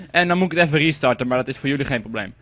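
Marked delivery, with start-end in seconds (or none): none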